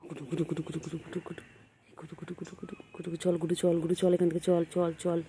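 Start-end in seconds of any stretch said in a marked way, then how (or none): 0.0-3.2 soft calling. Real, not acted. Softly calling pet to come.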